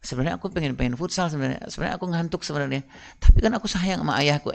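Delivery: a lightly voiced child's private thoughts, said softly; child's inner thoughts. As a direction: think it quietly to yourself. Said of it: "dia bilang".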